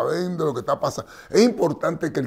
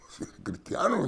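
whispered aside, softer; 5 semitones higher, 6 dB quieter than usual. Shown as a demonstration. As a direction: drop to a whisper for emphasis. Repeats it in a whisper.

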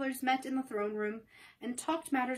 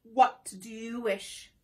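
slow, bowing monkey ruler; Monkey King; much slower than usual. Acted. Monkey King bowing to Dorothy, slow solemn voice. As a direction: ask it slowly and solemnly. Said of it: "said the Monkey King".

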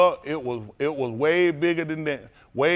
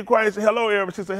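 ordinary talk

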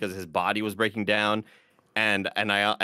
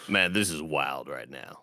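deep Paris impression; 7 semitones lower, 3 dB quieter than usual. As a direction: drop as low as you can go. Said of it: "Deep, gotta go deep".

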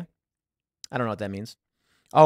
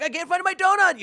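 high, lost, plaintive cop voice; a flustered police officer missing his donut; whiny cop. Retold or imitated. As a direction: cop whining about losing his donut, pitched way up. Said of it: "he's kind of like".

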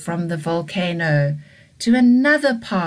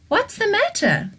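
much higher, light dinosaur voice; Bronnie asking kindly. Acted. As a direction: lift the voice way up to ask. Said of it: "Bronnie said".

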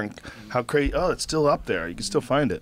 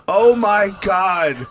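mild mock cry out; 5 semitones higher, 4 dB louder, much slower than usual. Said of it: "I hear a woman in the row behind us cry out".

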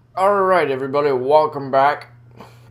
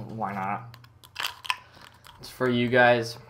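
ordinary delivery

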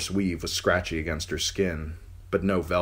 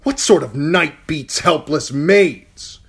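loud, higher, slowing angry challenge; angry demand; 10 semitones higher, 10 dB louder, slower than usual. Acted. Uncle confronting the knight, loud and angry. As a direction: demand it with cold fury.

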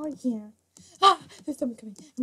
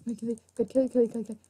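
ordinary talk, nothing marked

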